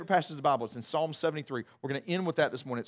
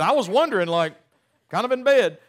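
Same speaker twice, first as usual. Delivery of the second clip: old woman voiced; 5 semitones higher, 9 dB louder than usual.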